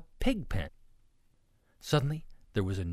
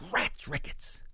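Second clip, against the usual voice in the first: whispered curse; 9 semitones higher, slower than usual. A narrator's reading. Ruby cursing under bed.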